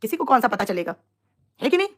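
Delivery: usual speaking voice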